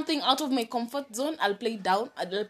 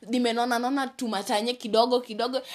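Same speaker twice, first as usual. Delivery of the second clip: normal talking voice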